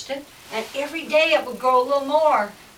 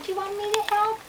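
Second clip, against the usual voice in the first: high, small mouse voice; little mouse; 10 semitones higher, faster than usual. Acted. Little mouse offers to help, high small voice. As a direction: pipe up like a tiny mouse. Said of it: "a little mouse came running across".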